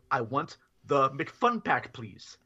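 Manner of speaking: slow repeat. Retold or imitated. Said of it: "I says".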